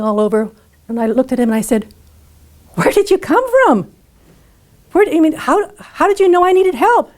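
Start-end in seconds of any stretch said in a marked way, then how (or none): 2.7-7.1 bewildered. Retold or imitated. Shaken woman questions her rescuer.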